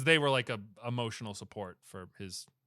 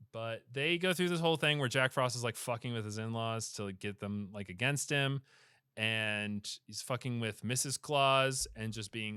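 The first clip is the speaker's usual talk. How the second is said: ordinary talk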